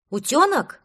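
loud high call. Acted. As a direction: call across to him. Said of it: "крикнул Кавриксон".

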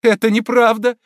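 high, loud refusal to believe; proud denial. A narrator's reading. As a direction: deny it forcefully. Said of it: "с жестом гордого отрицания".

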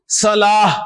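long salaah, صلاح closed on h; final h sound. Shown as a demonstration. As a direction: stretch صلاح and end it on h. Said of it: "صلاح پڑھیں گے نا کہ صلاۃ".

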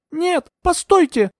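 very high, drawn-out cry; high, desperate call. A narrator's reading. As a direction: cry out to stop them, slowly stretched. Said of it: "выкрикнул я".

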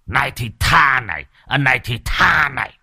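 vicious taunt. A narrator's reading. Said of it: "Vừa nói, lão vừa vụt mạnh hơn".

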